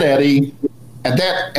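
usual speaking voice

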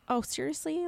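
usual speaking voice

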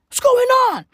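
high, loud outburst; incredulous high shout; exasperated yell. Acted. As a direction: demand an answer at the top of your range. Exasperated rant about New York.

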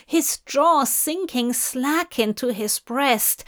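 ordinary delivery, nothing marked

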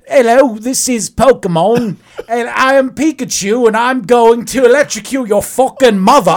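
hammed-up British game character; a British-accented Pokemon threatening you; mock British Pikachu. Host voices a British Pikachu for his guest in a mock accent. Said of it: "Is it in British?".

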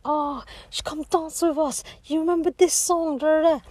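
high, loud party-guy invitation; hammed-up drunk lad beckoning her to dance; tipsy lads calling out. Guys at a bar invite her to dance, mock rowdy call.

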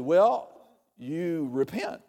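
ordinary talk

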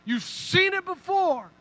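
Moses highlighting. Pretend. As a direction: wave the reader over to the key word. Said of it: "exposes it like".

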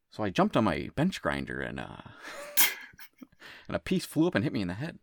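put-on comic movie-character voice; Bill Hader. Quoting Hot Rod to a co-host, put-on Bill Hader voice. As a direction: do an impression of a goofy film line. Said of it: "in Hot Rod".

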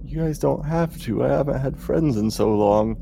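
high friendly NPC voice; Voria's normal voice. Acted. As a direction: speak like a lonely girl. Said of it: "Voria's voice has returned to normal".

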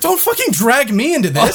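high-pitched retold protest, half laughing; exasperated protest. Guest to complaining stranger in line, exasperated high protest.

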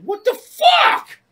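very loud, high, drawn-out yell; re-enacted road fury, last word stretched; outraged shout. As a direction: bellow at the driver who nearly hit you. Retold yelling at driver.

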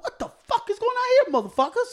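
high-pitched incredulous outburst; retold outrage. Reacting to kids getting three hangers each, high-pitched outrage.

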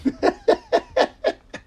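real high cackle at his own story; helpless high giggle; high-pitched laugh. Real, not acted. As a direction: crack up in a high, repeating laugh.

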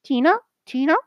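very high repeated squeaky calls; mock little-girl voice on repeat; imitating the girl. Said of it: "that's the only word she said".

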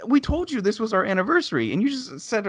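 high, indignant scolding reenactment. Retold or imitated. Reminding saleswoman it was their anniversary, indignant. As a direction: scold in a high, outraged retelling. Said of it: "I pretty much raised my voice at her".

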